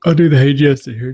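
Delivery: talking through a yawn. Real, not acted. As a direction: yawn while speaking. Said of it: "Let me quit yawning".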